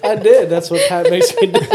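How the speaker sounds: laughing through the words. Real, not acted. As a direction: say it while still laughing. Laughing after snort.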